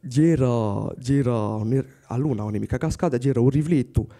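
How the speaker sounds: ordinary delivery, nothing marked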